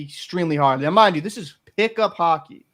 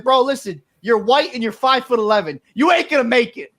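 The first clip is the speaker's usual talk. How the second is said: louder, higher trash talk at a try-hard; chirping taunt; 7 semitones higher, 7 dB louder than usual. Retold or imitated. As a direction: chirp him hard on the court.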